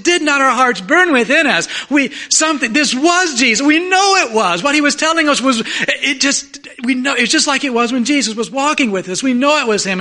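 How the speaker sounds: high, loud, tumbling excited testimony; breathless excitement. Acted. Two travelers telling they met Jesus, acted excitement. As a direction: burst out with it, stumbling over the words. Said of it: "They were so excited".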